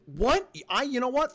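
high-pitched shocked what; surprised disbelief. Real, not acted. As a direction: react with stunned disbelief, voice jumping up. Shocked at Izzy's plan.

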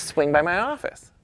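high, rising, insistent imitated repeat; repeated invitation. Camera executive repeating himself to puzzled photographer, insistent invitation.

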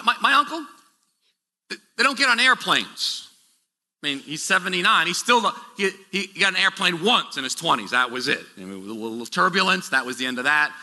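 usual speaking voice

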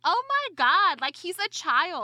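loud, high-pitched "oh my God"; retold outburst, high and loud, slowing at the end; exasperated disbelief. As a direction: react in appalled disbelief, loudly. Retelling her exasperated reaction to man.

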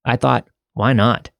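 ordinary delivery

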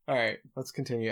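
usual speaking voice

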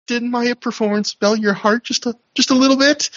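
high-pitched, timid imitated plea; mock-meek, tentative question; very meek Jimmy Jacobs. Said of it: "very meekly asks her".

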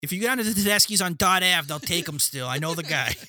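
high, loud, fast Boston local; Boston local giving a shady tip; hammed-up Boston local. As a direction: rattle it off like a know-it-all townie. Acted Boston local chiming in.

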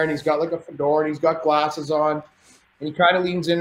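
ordinary delivery

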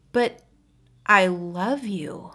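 slow, pleading declaration; cling to it desperately; desperate plea. Acted.

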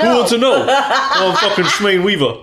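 loud, laughing New York tough guy; hammed-up New York wiseguy; New York accent. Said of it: "You need to do that with a New York accent".

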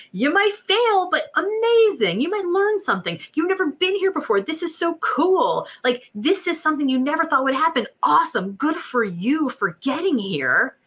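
high, thrilled cheering-on; cheerleader. Acted. Inner voice as cheerleader urging you forward, excited and high. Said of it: "allow it to be our cheerleader".

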